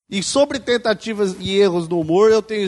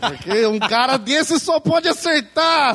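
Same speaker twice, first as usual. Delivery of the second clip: laughing while mocking him, higher; 4 semitones higher than usual. Real, not acted. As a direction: poke fun with a laugh. Mocking the guest's reputation. (zombando entre risadas)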